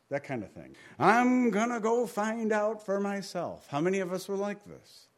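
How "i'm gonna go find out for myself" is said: defiant son. Retold or imitated. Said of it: "the son might think".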